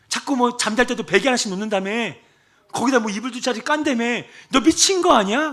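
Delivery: mock-stern pastor telling off his evangelist; scolding pastor. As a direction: scold her like a fed-up pastor.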